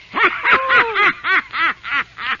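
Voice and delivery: high wicked witch cackle; evil cackle. Acted. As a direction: cackle in triumph. Witch triumphs.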